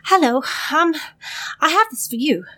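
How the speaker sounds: breathless, panting messenger voice; out of breath. Acted. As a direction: gasp the words out, winded. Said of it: "she's a little out of breath".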